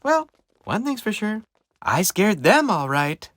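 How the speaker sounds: high, pleased character voice; cheery little pumpkin. Acted. Christopher having scared everyone, perky and pleased. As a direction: wrap up with perky satisfaction.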